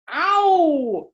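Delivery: grandpa's ow. Acted. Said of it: "said Grandpa".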